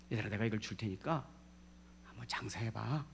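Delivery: low, hushed instruction; soft coaxing. Acted. Master entrusts money to his servants, softly. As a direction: drop to a near whisper at the end.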